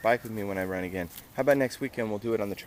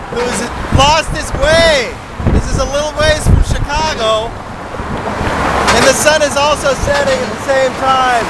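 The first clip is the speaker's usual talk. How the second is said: excited; 11 semitones higher, 14 dB louder, slower than usual. Real, not acted. Excited loud on bridge.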